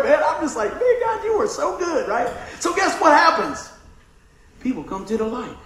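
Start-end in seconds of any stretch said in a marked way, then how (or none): 0.7-2.1 high laughing thanks to God; laughing praise. Retold or imitated. Fisherman laughing thanks to God over catch, much higher. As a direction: laugh it out in delight, pitch way up.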